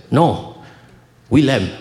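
put-on the dad correcting the teacher in a Haitian accent. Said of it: "the accent".

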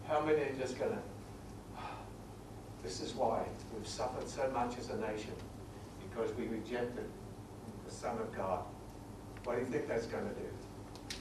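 choked-up, hushed and low; emotional. Real, not acted. Preacher overcome speaking of his nation's rejection, emotional. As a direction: drop to a soft, low voice, overcome with feeling. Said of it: "I get emotional".

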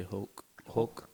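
lightly imitated Mexican accent; a touch of Mexican accent on Hulk. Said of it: "Mexicans with accents say Hulk".

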